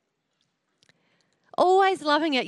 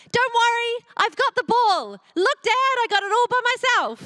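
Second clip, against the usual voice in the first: put-on proud kid; 7 semitones higher than usual. Son on the roof proudly calling to dad, excited. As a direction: show dad you did it yourself.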